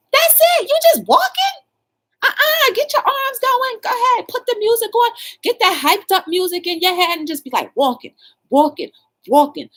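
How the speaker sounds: very high, loud cheering coach; hyped coach cheer. Acted. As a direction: pump her up, push her to move. Hyping Wanda up.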